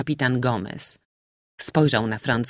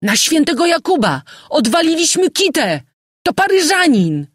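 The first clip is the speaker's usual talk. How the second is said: high, loud cry of alarm; panicked shout; 13 semitones higher, 7 dB louder than usual. A narrator's reading. Sailor alerts the ship to the dreaded Parisian, shouting. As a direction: yell it out at a high pitch. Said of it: "jeden majtek krzyknął".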